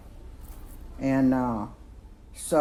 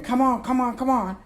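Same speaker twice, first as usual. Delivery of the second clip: high, urgent come on; mock urgent hurry-up; 9 semitones higher, faster than usual. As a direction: hurry her along, pitched up. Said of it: "and he's saying".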